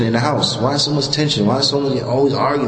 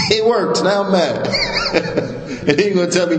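laughing; 3 semitones higher than usual. Real, not acted. Laughing while acting mad.

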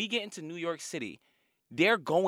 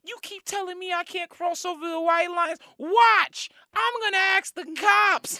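defiant driver; 12 semitones higher than usual. Retold or imitated. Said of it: "she said".

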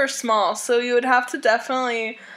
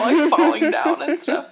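normal talking voice

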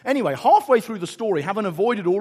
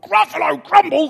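high, loud aghast echo; horrified Gruffalo; 9 semitones higher, 9 dB louder than usual. Acted. Gruffalo learning he may be eaten, loudly aghast. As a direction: cry it out in alarm.

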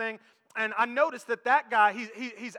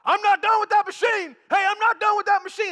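high, loud angry gym shout; yelling across the gym; 9 semitones higher, 8 dB louder than usual. Retold or imitated. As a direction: bellow it across the room, much higher and louder. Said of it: "This guy starts yelling across the gym".

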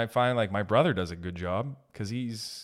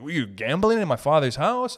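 Jesus in the temple; 5 semitones higher, 6 dB louder than usual. Retold or imitated. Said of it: "every Italian is like fucking Jesus".

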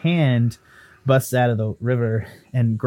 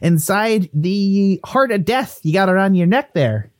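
higher, insistent Irish-Canadian voice; pleading ghost Willie; 11 semitones higher, 4 dB louder than usual. Acted. Ghost bargaining for a way out, insistent. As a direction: wheedle in a lilting mixed accent.

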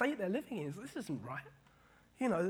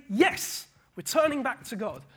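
louder, higher crowd's eager yes; emphatic, raised-pitch promise; eager pledge; 6 semitones higher, 8 dB louder than usual. Acted. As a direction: declare it keenly as the people. Voicing the people's eager promise.

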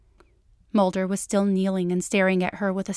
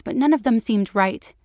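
usual speaking voice

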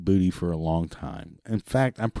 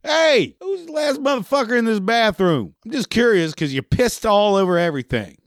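mock yell at the whole party to find the culprit; imagined yell; 11 semitones higher than usual. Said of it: "and just yell out".

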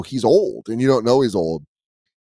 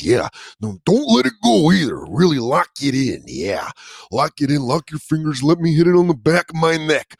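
Macho Man impression; 3 semitones higher than usual. Owner hyping dog as Macho Man, wild wrestling promo. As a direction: hype it up like Randy Savage cutting a promo. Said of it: "doing it as Macho Man".

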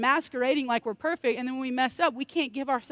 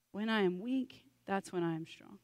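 soft repeat; 2 semitones lower, 8 dB quieter than usual. Acted. Repeats verse softly after climax.

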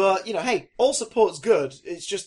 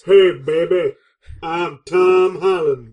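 loud, slow crooning seducer voice; flirty Tom Holland; 11 dB louder, slower than usual. Retold or imitated. Host plays Tom Holland seducing a fan, hammed-up croon. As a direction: lay on the charm slowly. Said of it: "That's not how Tom Holland sounds".

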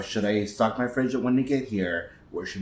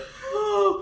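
spit take. Real, not acted. Beer went down wrong, spat.

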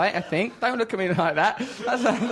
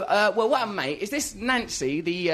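ordinary talk

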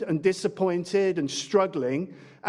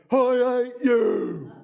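put-on shouted sulky teen; bratty teenage shout; Kevin the teenager impression; 6 semitones higher, 6 dB louder, slower than usual. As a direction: yell it like a stroppy thirteen-year-old. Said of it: "That's my only impression".

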